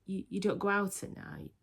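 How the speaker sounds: usual speaking voice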